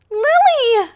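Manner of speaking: Lily! shrieked very high and loud; terrified scream. Acted. Screams the villain's name.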